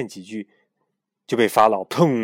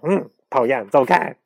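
annoyed; 3 semitones higher, 8 dB louder than usual. Acted. Annoyed chick shooing.

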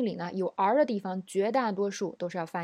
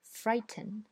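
British frighten with a clear t; British English; 3 dB quieter than usual. Shown as a demonstration. Teacher reads frighten in a British accent. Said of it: "我先读英音".

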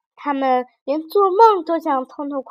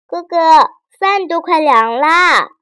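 put-on impatient little girl; 5 semitones higher, 7 dB louder than usual. A narrator's reading. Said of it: "等得不耐烦了".

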